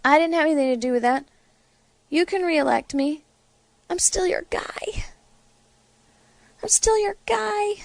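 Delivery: a hint of a soothing, oily reassurance; mock-soothing politician, lightly done; mocking Mitch McConnell. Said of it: "So Mitch McConnell says".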